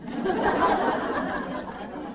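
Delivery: a high, airless burst of laughter; quick unvoiced chuckle; breathy laugh. Real, not acted. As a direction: laugh at the memory. Laughing at her own story.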